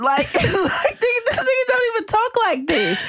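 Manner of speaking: high, laughing outburst through the words; cracking up mid-rant. Real, not acted. Laughing at the actor's fake twang while ranting to the cohost.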